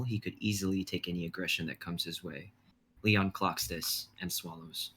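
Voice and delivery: measured dramatic narration; stage directions read. Acted. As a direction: set the scene like a script reading.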